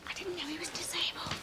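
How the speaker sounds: very soft, breath-only whisper; whispered aside. Acted. Hushed aside about a man nearby, whispered. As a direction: whisper it under the conversation.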